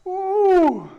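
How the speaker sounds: loud high whoop. Real, not acted. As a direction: cheer it out, relieved. Whoop at the workout's end.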